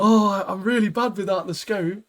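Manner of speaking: high, put-upon voice of a useless gunman; mock whiny shooter. Friends recapping a show, mocking the bad shot in a high whine. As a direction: whine it like a sniper lost without his scope. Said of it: "he's like".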